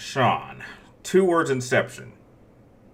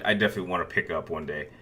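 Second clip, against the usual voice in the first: usual speaking voice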